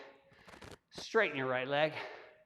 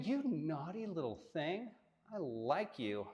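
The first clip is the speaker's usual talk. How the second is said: flirty tease; 7 dB quieter than usual. Acted. Teasing aside about practising naked.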